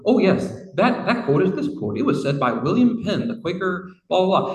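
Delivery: a hint of a smug AI answering at once; breezy chatbot. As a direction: rattle it off like an eager assistant. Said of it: "Chat G P T, of course, immediately had an answer".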